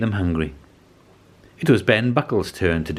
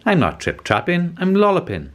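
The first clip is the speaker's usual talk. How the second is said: much higher, small rabbit voice; bouncy rabbit voice; 10 semitones higher than usual. Acted. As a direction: bounce it up high like a little rabbit. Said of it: "said a creature with long ears".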